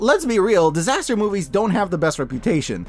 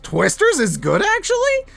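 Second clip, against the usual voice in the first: questioning tune, actually leaps very high; quoted title; 13 semitones higher than usual. Retold or imitated. Reviewer quotes video titles, sharp rising question on actually. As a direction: read the title with a doubtful upswing on actually. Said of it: "suggested videos titled".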